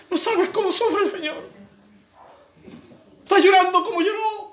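high, tearful, overdone sobbing preacher voice; mock weepy preacher. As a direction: parody the manipulative preacher crying for the Lord. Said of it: "No necesito como predicador tocar tus emociones".

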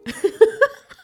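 high burst of laughter; real laugh. Real, not acted. Laughs at punchline.

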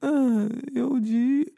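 soft pained groans after the dentist; dopey, fading ouch; groggy moaning. Real, not acted. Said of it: "E tá doendo". (gemendo grogue de dor)

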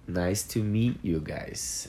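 said slowly and clearly for learners; slow model phrase. Shown as a demonstration. Teacher slowly models a greeting for students. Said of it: "teacher models phrase".

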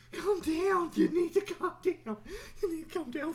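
high, frantic pleading; hammed-up, high-pitched freak-out; mock panic. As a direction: beg it frantically to settle down. Acting fed up with pop-ups.

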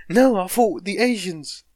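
loud, high, slow acted protest; mock protest. Comic acting out the reply, exaggerated loud protest.

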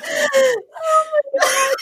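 slow, high shocked cry; drawn-out, flustered oh my god; embarrassed shock. Real, not acted. Asked an embarrassing question, shocked high cry. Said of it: "I'm bright red".

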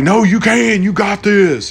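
a pilot hyping up a scared passenger; pumping him up. Retold or imitated. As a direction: cheer him on like a coach. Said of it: "trying to pump him up".

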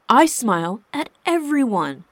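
exasperated, emphatic outburst. A narrator's reading. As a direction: raise your voice in self-defence. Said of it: "Seb rose his voice".

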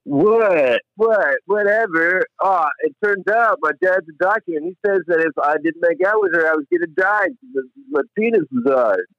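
high, dismissive whiny voice; mock Barry. [name] mocking Barry's excuses to Heather, high and whiny. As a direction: ham up the lame excuse.

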